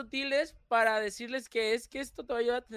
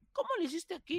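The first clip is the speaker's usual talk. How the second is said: high, soft dumb question voice; lightly voiced silly fan; mocking a distracted commenter; 8 semitones higher, 5 dB quieter than usual. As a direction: play the clueless commenter, pitched up. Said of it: "y me ponen".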